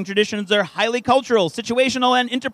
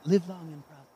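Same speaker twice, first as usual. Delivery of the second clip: fainting dying voice; 5 semitones lower, 8 dB quieter, much faster than usual. Retold or imitated. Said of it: "only said in a fainting, dying voice".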